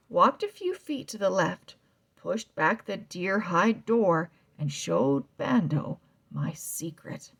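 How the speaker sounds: ordinary delivery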